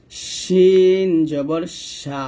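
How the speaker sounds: শিন held very long, mid-tongue to the palate; slow, full sh sound in শিন; hushing shin. Shown as a demonstration. Teacher placing mid-tongue for learners, hushing shin. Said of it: "শিনটা জিহ্বার মধ্যখান".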